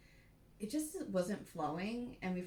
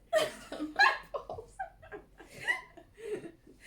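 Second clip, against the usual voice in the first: long, much higher squealing laugh; high burst of laughter; 8 dB louder than usual. Real, not acted. Cracking up at the cat joke, shrieking laugh.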